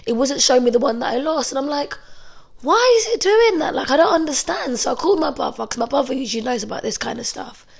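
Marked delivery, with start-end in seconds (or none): 2.6-4.8 high-pitched, exasperated questioning; retold bafflement. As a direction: act out puzzled disbelief, pitch leaping up. Retold bafflement at the phone app.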